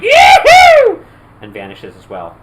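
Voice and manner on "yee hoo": put-on very high, loud drunken pirate yee-hoo; whooping holler. Drunk pirate chasing djinns through a portal, whooping holler. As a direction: whoop like a cowboy charging in.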